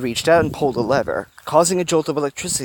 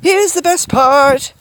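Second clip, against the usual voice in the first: high, loud, slow sing-song; 7 dB louder, much slower than usual. A narrator's reading. Woman before poking the ball, sing-song. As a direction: draw it out in a high teasing lilt. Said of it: "said in the sing-song voice".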